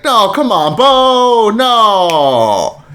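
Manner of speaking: high, drawn-out plea of disbelief; dismayed pleading. Acted. Viewer begging [name] not to be bad, dismayed pleading. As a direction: wail it in mock dismay, sliding down on "no".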